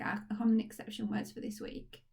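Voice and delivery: ordinary talk, nothing marked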